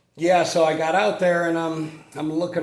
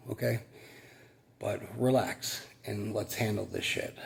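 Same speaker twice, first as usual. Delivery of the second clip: low, softer calm self pep talk; pep talk to himself; 4 semitones lower, 7 dB quieter than usual. Retold or imitated. Sweaty tech coaching himself down from frustration, calm and low. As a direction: talk yourself down like a buddy. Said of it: "that little pep talk".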